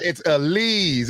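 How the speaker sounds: "Alize" drawn out slowly as uh-LEEZ; "Alize" repeated carefully with a long EE; corrected pronunciation. Real, not acted. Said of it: "You know, you're right".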